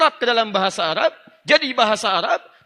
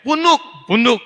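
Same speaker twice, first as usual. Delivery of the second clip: emphatic example; 4 semitones higher, 6 dB louder than usual. Shown as a demonstration. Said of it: "Betong, batnun".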